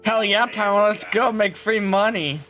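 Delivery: usual speaking voice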